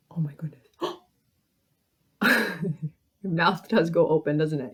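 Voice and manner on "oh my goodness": gasp, then a whispered reaction; hushed, breath-taken shock; whispered astonishment after a big gasp; acted surprise. Shown as a demonstration. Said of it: "But surprise".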